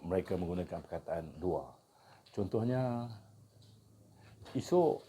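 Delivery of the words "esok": Kedah dialect example sentence; kedah malay accent. Shown as a demonstration. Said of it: "Nah, maksudnya".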